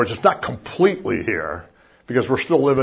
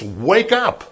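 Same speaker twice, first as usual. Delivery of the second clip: high, ringing call to wake; startling loud call; 10 semitones higher than usual. Retold or imitated. Preacher imitating John's startling wake-up call. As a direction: jolt them out of sleep. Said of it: "startling loud voice".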